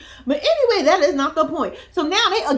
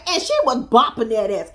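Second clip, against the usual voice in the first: ordinary talk, nothing marked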